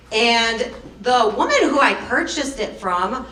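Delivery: ordinary delivery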